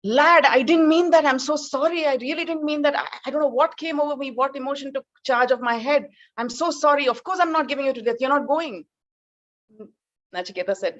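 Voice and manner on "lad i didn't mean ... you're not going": much higher, rushed apology; father frantically taking it back, higher and faster; panicked apologetic father. Retold or imitated. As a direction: gabble out sorry, horrified at himself. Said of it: "and he's like".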